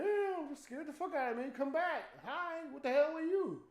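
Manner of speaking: startled calling out. Retold or imitated. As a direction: call after it, still rattled. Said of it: "I'm like".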